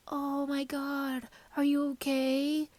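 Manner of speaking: high, slow, overdone worried friend; mock gushing concern. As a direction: ham up the fussing concern. Mocking over-concerned reaction.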